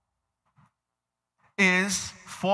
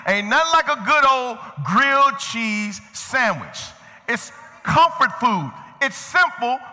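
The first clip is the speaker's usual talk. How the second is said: rising, higher-pitched preacher fervor; preaching; 6 semitones higher than usual. Real, not acted. Preacher rousing the congregation about grilled cheese, fervent preaching. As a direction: preach it up with stretched-out key words. Preacher gets worked up over comfort food.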